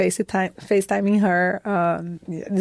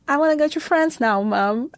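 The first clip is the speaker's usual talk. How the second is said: self as a daughter; 7 semitones higher than usual. Retold or imitated. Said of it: "and say".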